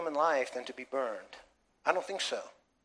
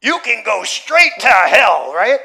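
slow, loud, spat-out curse; furious, drawn-out shout at someone; angry curse; 5 semitones higher, 17 dB louder, slower than usual. Retold or imitated. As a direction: tell someone off with full heat, slowly. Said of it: "we get angry at someone".

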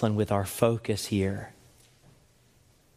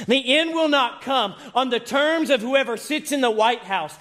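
preaching at full force; 12 semitones higher than usual. Real, not acted. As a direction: build the congregation toward the climax.

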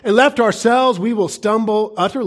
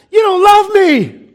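put-on high, loud, slow wail of a boy; high, loud tantrum whine; whining child; 12 semitones higher, 8 dB louder than usual. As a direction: wail like an eight-year-old in a tantrum. Boy throws a fit.

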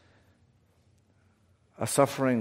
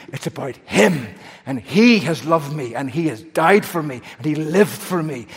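louder, rising sermon build-up; full-force preaching; 4 semitones higher, 7 dB louder than usual. Acted. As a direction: hammer each line home from the pulpit. Preaching the gospel to the congregation.

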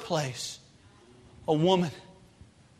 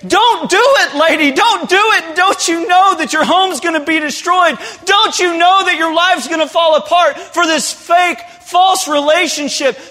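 high, loud, impassioned cry; pleading shout; 10 semitones higher, 6 dB louder than usual. Acted. Preacher shouting at the woman in the office, impassioned. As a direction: cry out to stop her, rising to a peak. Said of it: "we say".

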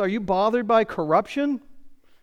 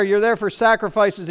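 ordinary delivery

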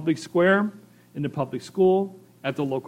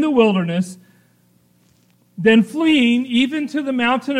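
raised, louder preacher voice building up; fervent preaching; 5 semitones higher, 5 dB louder than usual. Acted. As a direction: preach it with fire to the congregation.

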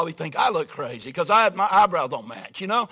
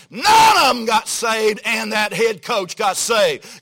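preaching shout; 6 dB louder, slower than usual. Real, not acted. Preacher hitting the climax.

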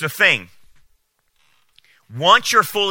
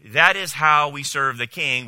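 ordinary talk, nothing marked

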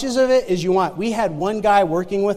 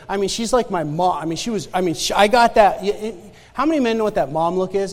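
ordinary delivery

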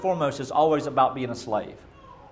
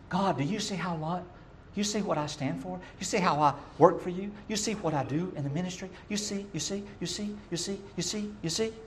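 softer, higher pleading whine; whiny Cain; 4 semitones higher, 9 dB quieter than usual. Retold or imitated. Cain pestering God to notice his ministry, whiny and soft. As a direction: needle God like a needy child wanting praise.

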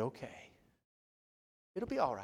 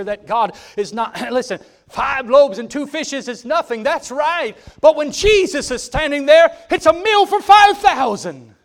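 climbing higher and louder, a full-throated preacher's cry; preaching at full force; 11 semitones higher, 16 dB louder, slower than usual. Acted. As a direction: build it to a ringing pulpit shout.

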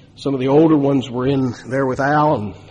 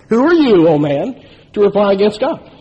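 louder, higher retold rebuke; pointed rebuke; 5 semitones higher, 4 dB louder than usual. Man hears the verse as aimed at him, sharp rebuke. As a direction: fire the verse at the man like an accusation. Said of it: "He thinks Al is talking to him".

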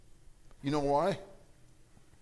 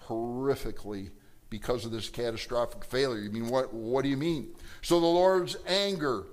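ordinary talk

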